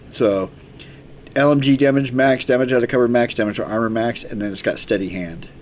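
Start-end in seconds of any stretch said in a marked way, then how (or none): none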